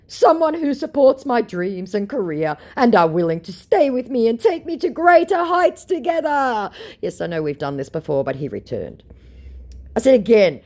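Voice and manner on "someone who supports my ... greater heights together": scammer voice. Retold or imitated. Reading scammer's message aloud.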